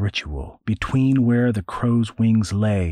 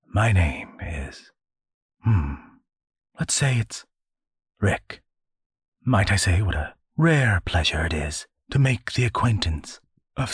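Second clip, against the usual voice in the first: suave angel voice. Acted. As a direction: play it smooth and amused.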